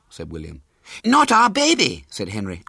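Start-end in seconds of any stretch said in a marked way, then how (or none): 1.0-2.0 high, loud and drawn out; alarmed insistence. A narrator's reading. Henry correcting William about the baby, alarmed insistence.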